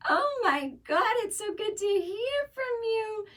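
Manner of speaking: put-on very high, drawn-out elderly mother gushing; delighted mom impression. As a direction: gush slowly in a high mom voice. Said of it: "she was like".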